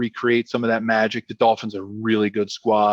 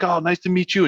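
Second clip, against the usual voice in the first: lightly voiced young quarterback, pitched up; higher, milder imitation of a polite reply; imitated polite reply; 7 semitones higher than usual. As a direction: answer dad's greeting in a higher voice. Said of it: "and he's like".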